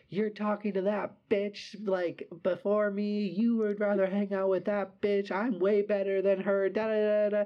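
imitating a jealous drunk friend whining; mocking jealous drunk. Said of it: "And she's just like".

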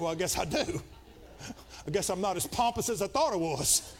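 chuckling through the admission; laughing. Real, not acted. Preacher admitting to his congregation he wants gifts, laughing. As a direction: laugh as you own up to it.

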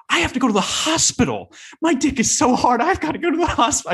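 high, fast panicky pleading; mock panic about the hospital; panicked freaking out. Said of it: "that's how bad I was freaking out".

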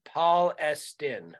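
"Paul Estin" said slowly, like "Paul" then "Estin"; local pronunciation. Shown as a demonstration. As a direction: draw out "Paul Estin" as two words, slowly. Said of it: "Residents pronounce it".

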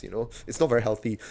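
ordinary talk, nothing marked

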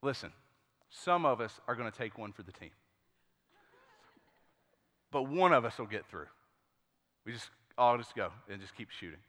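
low, soft, grave mock-heroic briefing; rally the team in a low, quiet voice; retold leader's solemn words, kept low with pauses; hushed huddle pep talk.

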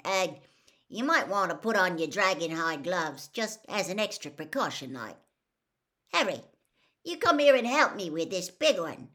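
a hint of a big friendly gamekeeper; hearty instructions as Hagrid; lightly voiced Hagrid.

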